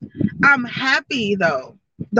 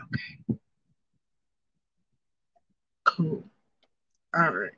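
swallowed, much softer okay; hushed, lower, holding the anger in; forced calm; 6 semitones lower, 11 dB quieter than usual. Retold or imitated. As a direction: bite it back and go quiet. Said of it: "So I'm just like".

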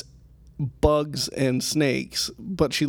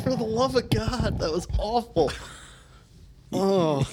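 pained; 7 semitones higher than usual. Real, not acted. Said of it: "He's grimacing in pain".

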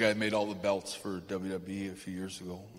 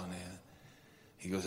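usual speaking voice

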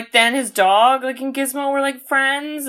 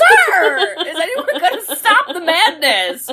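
mock outrage; 7 semitones higher, slower than usual. Acted protest at the film's scene.

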